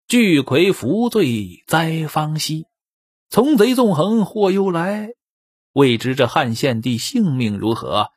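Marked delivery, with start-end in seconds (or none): 0.1-5.1 couplet recitation. A narrator's reading. Storyteller's closing couplet.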